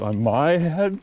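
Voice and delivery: put-on a confused child asking back, "head" rising high; puzzled kid. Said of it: "And the kid goes".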